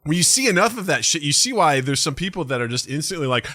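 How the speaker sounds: ordinary talk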